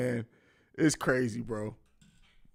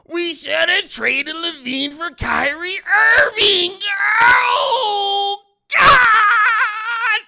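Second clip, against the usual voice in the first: high crying shout, stretched out; whining fan; 13 semitones higher, 7 dB louder, much slower than usual. Acted. Bulls fan wishing for an Irving trade, mock wailing. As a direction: wail it, swelling into a long anguished cry. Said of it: "Whining ass Bulls fans".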